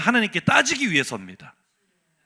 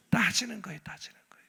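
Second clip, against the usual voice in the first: whispered, much softer; 2 semitones lower, 9 dB quieter, faster than usual. Acted. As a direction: breathe it as a secret aside. Preacher drops to whisper. (속삭이듯 조용히 반복)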